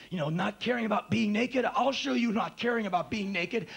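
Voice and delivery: mock-defiant outburst, pitched high; over-the-top defiance. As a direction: throw it out as a hammed-up dare. Acts his over-the-top defiant self.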